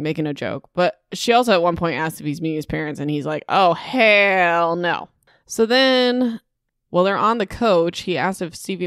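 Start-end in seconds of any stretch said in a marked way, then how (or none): 3.5-5.1 "hell" stretched out long; slow, drawn-out "hell no"; retold emphatic refusal. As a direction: drag out HELL in mock refusal.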